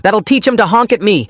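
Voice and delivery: high-pitched mock shriek; shrieked line. A narrator's reading. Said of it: "Isabella shrieked".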